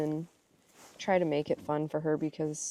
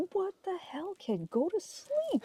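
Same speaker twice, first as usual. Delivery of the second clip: high, exasperated mock grumble at the kid; groggy mock scold of a daughter up too early; 3 dB quieter than usual. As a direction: play up the fed-up half-asleep mom. Mom retelling groggy scolding of kid.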